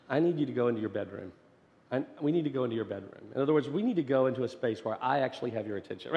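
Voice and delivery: quick, earnest rush of words; rushed kid insistence. Retold or imitated. Son urging his dad to the bedroom, fast and earnest. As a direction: hurry it out like an eager kid.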